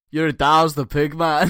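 loud Scottish punchline; Scottish accent. Acted. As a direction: land the punchline in broad Scots. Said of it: "read out in my best Scottish accent".

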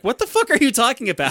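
high, loud, laughing disbelief; shocked outburst. Retold or imitated. Clerk hearing a guest's shocking plan, laughing outraged disbelief. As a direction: squeal it through laughter, half appalled.

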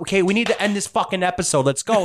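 high, hurried bossy villain; impatient villain. Acted. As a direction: rush the henchman along, snappy and impatient. GM voices villain hurrying her minion.

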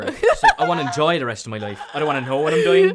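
laughing through fast words. Real, not acted. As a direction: laugh while speaking quickly. Laughing while joking about future visions.

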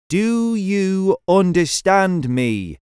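slow, loud, over-clear question. Acted. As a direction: stretch each word out. Repeats question to alien.

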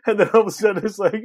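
ordinary talk

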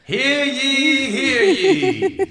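high, slow proclamation voice; town crier. Pretend. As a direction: announce it like a herald. Mock royal proclamation.

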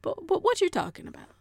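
high, quick stammered question; high-pitched, hurried query; different inflection. Shown as a demonstration. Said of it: "it's all in the way that you say it".

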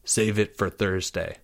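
very soft, low aside; hushed, low advice; whispered. A narrator's reading. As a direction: murmur it privately to him. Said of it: "Jake whispered".

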